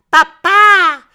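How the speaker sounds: put-on very high, loud child's call; little Josette calling. A narrator's reading. Small girl calling her sleeping father, high loud call. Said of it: "says Josette".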